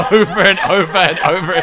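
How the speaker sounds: helpless laughter through the words; laughing. Real, not acted. Host complains about colleague's repeated Oh, laughing hard. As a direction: crack up while complaining.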